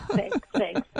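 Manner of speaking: thanks through a real laugh. Real, not acted. Guest laughing at the host's compliment, laughing.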